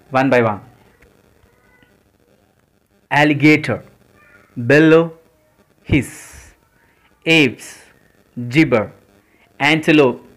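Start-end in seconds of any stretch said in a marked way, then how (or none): 5.8-6.5 whispered. Shown as a demonstration. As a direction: whisper the word hiss. Listing animal sounds, word acted.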